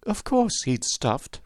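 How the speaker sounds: put-on high, slow, cross girl's voice; still angry. A narrator's reading. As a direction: answer as a mildly cross child. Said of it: "who was still angry".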